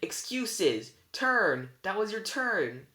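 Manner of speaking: high, loud cranky outbursts; angry squabbling. A narrator's reading. Old sisters fighting over whose turn it is, angry squabbling.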